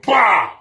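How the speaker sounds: sudden loud bang imitated. Describing the deer hitting his door, a loud bang. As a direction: mimic the crash, loud and sharp.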